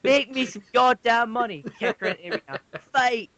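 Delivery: high mock shouting owner yelling at her doll; greedy owner. Doll owner yelling at her fighting doll, mock shout. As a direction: bark orders like a greedy stage mom.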